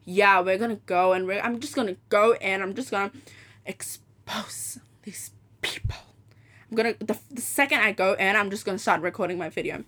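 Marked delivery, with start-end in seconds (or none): 3.7-6.0 slow, hushed, drawn-out whisper; dramatic whisper. Real, not acted. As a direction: whisper it slowly like a secret threat. Vowing to expose the salon.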